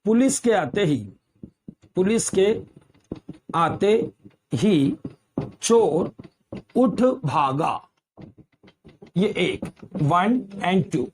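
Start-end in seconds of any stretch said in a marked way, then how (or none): 0.0-7.9 word-by-word dictation with long gaps; slow, deliberate, spaced for writing; dictating. Shown as a demonstration. Teacher writing out an example for students, dictating.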